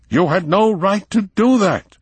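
a mild man finally angry; indignant protest. A narrator's reading. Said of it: "his voice raised in uncharacteristic anger".

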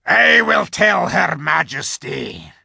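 high, loud, slow wolf voice. A narrator's reading. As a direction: speak like a guard wolf. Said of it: "said the wolf".

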